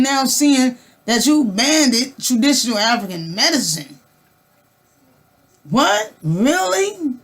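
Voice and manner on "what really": high disbelieving what, really; incredulous. Real, not acted. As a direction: sound stunned and outraged. Reacting to medicine ban.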